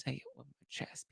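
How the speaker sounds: ordinary delivery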